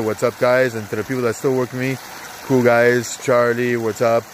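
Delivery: usual speaking voice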